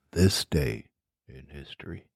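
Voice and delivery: deep, hushed documentary narrator; low, grave history-show intro, fading away; Smithsonian voice. Acted. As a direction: drop low and soft like a museum film narrator. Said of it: "let me add in my Smithsonian voice".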